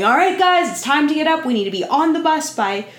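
big booming wake-up call; loud booming voice. Retold or imitated. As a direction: boom it out like a camp leader. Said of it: "Daniel's loud, booming voice".

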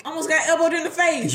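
loud and high, worked up; excited. Real, not acted. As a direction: tell it with rising outrage. Excited retelling, louder.

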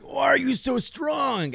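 high, slow hammed-up yell; mock shout. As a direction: wail it out in mock disbelief. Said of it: "I'm like".

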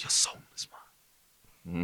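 cold hissing whisper; breathy, menacing demon whisper; cold hissing layered whisper. Pretend. Sinister mock voice.

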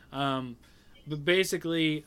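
ordinary talk, nothing marked

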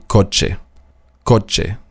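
coche with ch said "tsch"; CH as German TSCH. Shown as a demonstration. Teacher giving the CH example, coche with a TSCH sound. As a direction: say coche as "kotsche", twice. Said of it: "wie ein T S C H im Deutschen".

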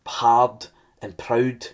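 very slow, each word weighed; drawn-out emphasis. Acted. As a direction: stretch out hard and proud. Preacher listing sins, emphatic.

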